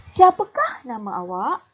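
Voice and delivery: loud, clear question tune rising on awak; teacher demonstrating a question; question intonation. Shown as a demonstration. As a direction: siapakah nama awak? rising at the end. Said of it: "Cikgu ulang lagi sekali".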